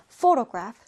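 "photograph" said slowly, PHO strong; stress on first syllable. Shown as a demonstration. Teacher repeating photograph for students, first-syllable stress. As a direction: lean hard on the opening syllable of "photograph". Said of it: "맨 첫 음절에 강세 있어요".

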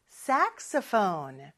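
saxophone slow, stress on SAX then falling; saxophone pitch contour. Shown as a demonstration. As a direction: let saxophone rise and fall, SAX first. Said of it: "이렇게 높낮이가 있어요". (saxophone 높낮이 살려서)

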